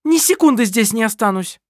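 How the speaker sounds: loud, very high outburst; shouting. Acted. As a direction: scream that you've had enough. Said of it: "вскричала Керстин".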